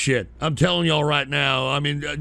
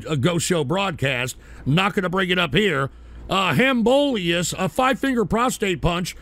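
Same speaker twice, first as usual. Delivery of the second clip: ordinary talk, nothing marked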